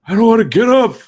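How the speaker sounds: put-on high, loud kid's whine; whiny protest. Acting out a kid who won't get out of bed, whiny. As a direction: whine it out in mock rebellion.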